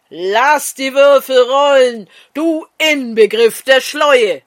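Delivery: rousing, full-voiced shout; calling out. A narrator's reading. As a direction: call it out boldly to everyone. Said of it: "riefen die Schiriner Mullahs".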